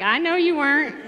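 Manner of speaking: loud, high playful comeback; teasing retort. Retold or imitated. Daughter answering dad's claim he was joking, teasing loudly.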